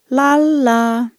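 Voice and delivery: loud, high sudden "Lallaa"; loud sing-song call. A narrator's reading. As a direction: belt it out, high, so it echoes. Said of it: "Liina äänähti isosti".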